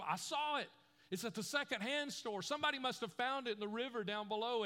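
a breathless schoolgirl bursting with news; excited schoolgirl telling the boy she found his boat; excited little girl. Retold or imitated. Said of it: "She said".

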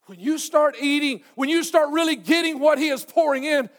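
high, loud, rising fervor; impassioned preaching. Real, not acted. Preacher urging listeners to take what is poured in, impassioned. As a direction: preach it out with full voice.

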